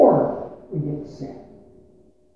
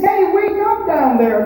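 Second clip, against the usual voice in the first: a higher call downward; God calling out; 10 semitones higher, 4 dB louder than usual. Acted. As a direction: call down to wake them. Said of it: "He says".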